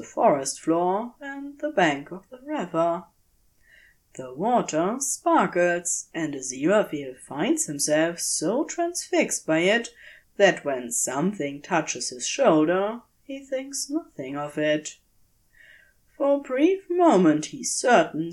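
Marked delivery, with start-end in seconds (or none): none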